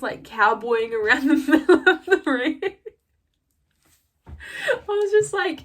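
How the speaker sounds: talking through laughter, voice shooting up high; real laughing. Real, not acted. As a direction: crack up while telling it. Cracks up retelling the hat grab.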